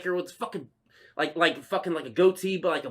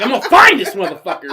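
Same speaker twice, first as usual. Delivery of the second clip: loud, higher, laughing threat; laughing mock vow; 5 semitones higher, 12 dB louder than usual. Driver eyeing every passing van, laughing loud vow. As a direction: swear it loudly through laughter.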